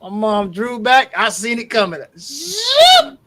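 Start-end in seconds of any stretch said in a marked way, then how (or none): 2.2-3.2 high, quick swoosh noise; sharp mock whoosh; whoosh sound effect. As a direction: voice the slap coming. Mom's hand drawing back.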